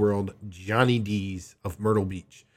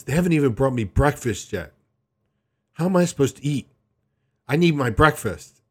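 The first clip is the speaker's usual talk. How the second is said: mom's complaint; 4 semitones higher, 6 dB louder than usual. Retold or imitated. Mom complaining about breakfast.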